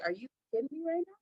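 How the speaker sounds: quick, high, breathy disbelief; are you kidding me, said in quick high mock shock. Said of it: "And I'd be like".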